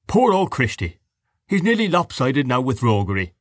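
a genial host just home from a walk; jovial father. Acted. Mr Dedalus mocking Christy.

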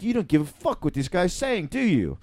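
put-on British accent, imitating; British dude. Retelling British dude's telling-off at Chili's, imitated British accent. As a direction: quote him in a mock British voice. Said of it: "I saw a British dude at Chili's".

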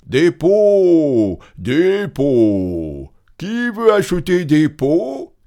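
loud, high, long-held hawking call; street vendor's cry. A narrator's reading. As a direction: call your wares down the streets. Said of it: "cria-t-il dans toutes les rues". (cri de marchand ambulant)

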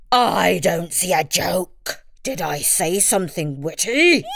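lightly voiced puzzled tiger; slow, a hint of tiger; mild puzzled tiger. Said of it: "asked the tiger".

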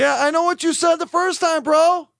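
high, exasperated retort; annoyed. Real, not acted. Telling his co-host he heard the first time, exasperated and high. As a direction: fire it back, fed up with the repeat.

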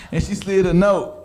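ordinary talk

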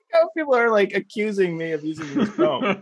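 high laughing protest. Real, not acted. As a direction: squeal it through laughter.

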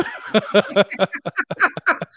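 high burst of laughter; real loud laugh; big laugh. Real, not acted. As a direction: laugh loud at your own punchline. Laughing after her own punchline.

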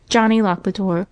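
plain reading voice

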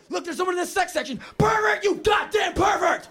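high, loud accusing yell; pretend outraged shout. Imagined library patrons, shrieking in outrage. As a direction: scream pervert at him.

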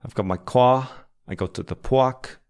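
demonstrated New York accent with rounded vowels. Shown as a demonstration. Teacher showing off an accent to viewers, imitated New York accent. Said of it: "I can do a good New York accent".

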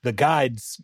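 ordinary delivery

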